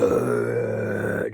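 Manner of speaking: long, guttural imitated monster growl; monster roar. Storyteller showing what the big monster dude sounded like, imitated roar. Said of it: "they had, like, a big monster dude".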